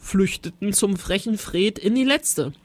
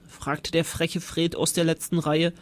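plain reading voice

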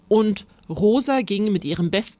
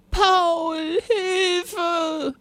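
high, drawn-out cry; screaming for help; 12 semitones higher than usual. A narrator's reading. Rosa calling Paul from the monster's grip, screaming. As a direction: wail for rescue. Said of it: "schrie Rosa".

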